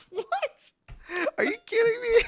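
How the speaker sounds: high, loud squeal of disbelief; shocked disbelief. Retold or imitated. Son reacts to dad's remark, in squealing mock disbelief. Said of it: "I was like".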